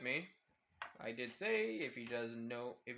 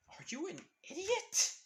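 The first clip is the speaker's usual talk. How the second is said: much higher, incredulous scolding; exasperated snap. Retold or imitated. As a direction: scold a clueless friend, pitch shooting up. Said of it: "Izuku looking at Naruto saying".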